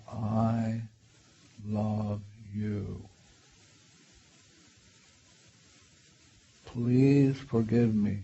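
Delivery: put-on slow, soft words to an inner child; guided meditation. Shown as a demonstration. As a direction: speak tenderly, leaving long pauses. Models tender words to inner child.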